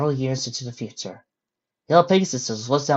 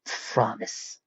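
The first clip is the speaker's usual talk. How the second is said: whispered promise; 9 dB quieter than usual. Acted. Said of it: "with a confident spark".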